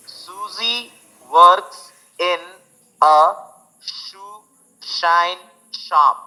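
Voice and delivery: "shoe shine shop" very slow, each sh clear; slow tongue twister demo. Shown as a demonstration. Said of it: "यहाँ पे श sound आएगा".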